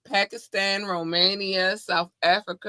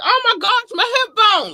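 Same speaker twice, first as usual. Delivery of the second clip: louder, higher mock cry of pain; mock hurt dancer; 7 dB louder than usual. Host mimicking a dancer hurt by daggering, higher mock wail. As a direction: ham up a dancer breaking a hip. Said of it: "just like".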